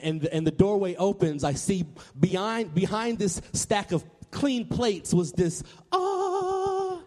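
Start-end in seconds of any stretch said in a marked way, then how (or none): 5.9-7.0 high, sustained angelic note; long, high note of revelation; heavenly choir "aah". Acted. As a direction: sing out a mock-celestial "aah". Heavenly choir sound revealing the buffet.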